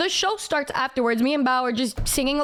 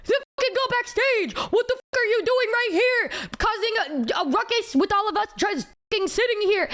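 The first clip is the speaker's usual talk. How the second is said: high-pitched, exasperated challenge; worked-up retold telling-off; angry retort; 7 semitones higher than usual. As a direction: call their bluff, indignant. Her retort in retold quarrel.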